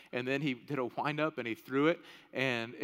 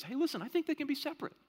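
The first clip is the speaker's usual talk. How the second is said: a lightly voiced objector, higher pitch; mock objection in a higher voice; imagined objector; 10 semitones higher, faster than usual. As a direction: raise the pitch slightly protesting, as someone arguing back. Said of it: "So to the person that says".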